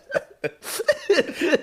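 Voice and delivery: squealing laugh; high laugh. Real, not acted. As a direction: cackle high in the voice. Laughing at Nora's threat.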